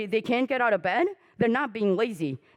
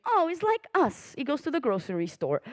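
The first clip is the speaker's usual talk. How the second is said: naive admirer; 4 semitones higher than usual. Retold or imitated. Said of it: "ninety-five will think".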